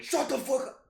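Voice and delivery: high, loud mock shout; angry dad. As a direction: imitate a parent blowing up. Imagined dad snaps at kid.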